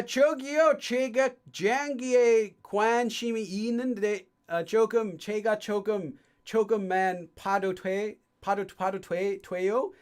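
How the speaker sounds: Korean in a thick American accent; deliberately bad, American-accented Korean; heavy American accent. Shown as a demonstration. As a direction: butcher the Korean like an American beginner. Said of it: "Say a Korean sentence in your most American accent".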